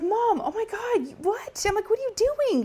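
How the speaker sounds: high, astonished squeal of surprise; shocked disbelief. Retold or imitated. Daughter realising mom pranked her, shocked high squeal. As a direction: thrown off, realising it was mom all along.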